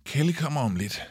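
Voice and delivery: plain reading voice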